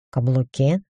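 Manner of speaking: puzzled rising question. A narrator's reading. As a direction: ask it back, confused. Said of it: "озадаченно переспросил дракон".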